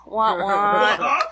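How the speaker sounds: sad trombone wah wah; mock whining. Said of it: "I often feel like the third wheel".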